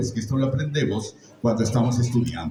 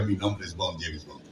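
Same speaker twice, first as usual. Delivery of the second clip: low, suave secret agent; understated spy cool; a hint of James Bond, quiet; Bond line; 4 semitones lower, 8 dB quieter than usual. Shown as a demonstration. Voice actor quotes Bond line.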